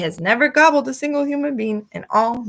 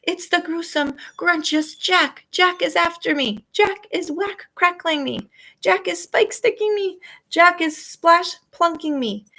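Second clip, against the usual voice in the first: panicked giant; 8 semitones higher than usual. A narrator's reading. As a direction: wail in terror at each name. Giant panicking about Jack.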